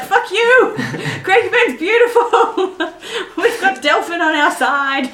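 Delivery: high, loud angry mock rant, laughing; mock hate mail. Imagined Craigieburn resident, angry, shouting back through laughter. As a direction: ham up an outraged local.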